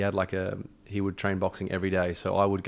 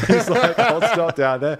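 laughing through the words; high, loud and giggly; 7 semitones higher, 7 dB louder, slower than usual. Real, not acted. Laughing while telling a story.

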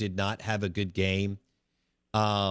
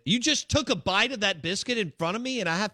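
mock outrage; 10 semitones higher, faster than usual. Real, not acted. Mock complaint at cohost eating.

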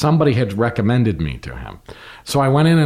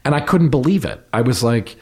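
ordinary talk

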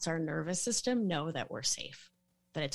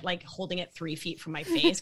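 ordinary delivery

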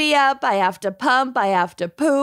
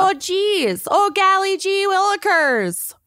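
put-on Minnesotan accent; 10 semitones higher than usual. Mock Minnesotan right after intro.

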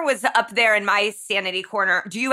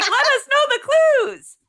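high mock plea; 14 semitones higher than usual. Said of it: "I'm like".

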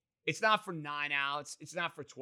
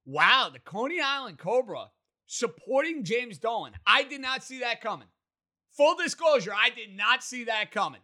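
surprised; 7 semitones higher, 7 dB louder than usual. Real, not acted. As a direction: genuinely caught off guard, exclaiming. Host surprised by caller's opinion.

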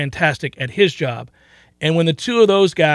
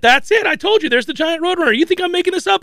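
high-pitched, worked-up insistence; insistent excitement; 11 semitones higher, faster than usual. Real, not acted. Host defends his roadrunner story to his co-host, insistent. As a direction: protest excitedly, pitched well up.

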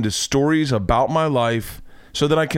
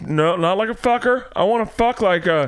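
higher, fumbling request to a doctor; embarrassed patient; 8 semitones higher than usual. Retold or imitated. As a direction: stammer it out awkwardly. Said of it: "tell them".

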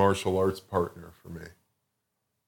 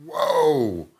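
a startled, amazed whoa, a bit higher; 4 semitones higher than usual. Retold or imitated. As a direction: gasp in wonder at the moving ceiling. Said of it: "and I'm like".

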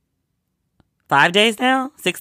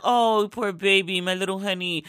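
mock sympathy; 4 semitones higher, 4 dB quieter than usual. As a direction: pretend to baby someone, sweetly trailing softer. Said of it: "not even like".

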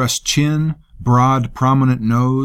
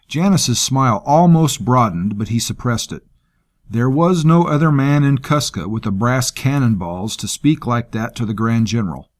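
ordinary delivery, nothing marked

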